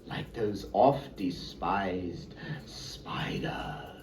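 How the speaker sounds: slow spooky whisper. Acted. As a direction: breathe it slowly like a secret. Creepy spiders aside, unvoiced.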